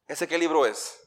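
usual speaking voice

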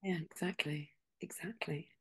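soft breathy whisper of agreement. Real, not acted. Host quietly agreeing with her guest, in a whisper. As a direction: murmur it under your breath.

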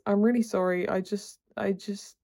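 usual speaking voice

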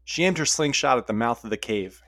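ordinary delivery

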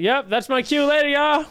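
high-pitched joking sign-off, rising; mock quick exit. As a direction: play someone cheerfully bailing out. Jokingly voices friend bailing mid-speech.

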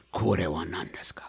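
low menacing whisper; sinister Japanese villain. Shown as a demonstration. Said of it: "it comes out sounding like".

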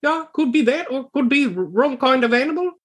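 high squeaky voice of the odd companion; Talks to herself voice. Acted.

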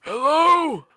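high, loud put-on guest greeting; playful fake Chris hello; Chris Ventura voice. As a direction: barge in as the streamer Chris.

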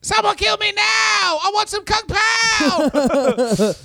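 high, slow wailing promo yell; wrestling promo shout. Retold or imitated.

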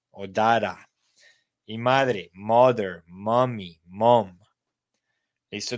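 ordinary delivery

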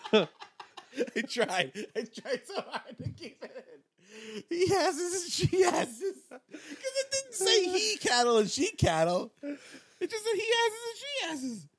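helpless high laughter through the words; cracking up. Real, not acted. Cohost losing it over he asses, cracking up. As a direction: try to talk through a laughing fit.

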